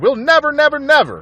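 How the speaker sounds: high, loud repeated vow; crying out. A narrator's reading. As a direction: hammer out each never. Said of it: "cried Boggis".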